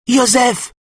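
high-pitched desperate call; a lightly acted cry of despair. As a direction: sister cries her brother's name, pitched high. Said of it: "crie Evelyne désespérément".

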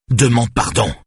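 high, loud, falling command; angry order. A narrator's reading. Father ordering his daughter to apologise, angry. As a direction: demand it forcefully, in a rage.

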